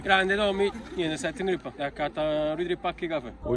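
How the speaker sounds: ordinary talk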